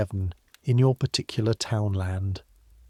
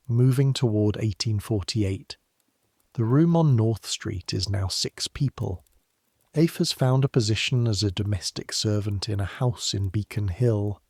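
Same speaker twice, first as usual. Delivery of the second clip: usual speaking voice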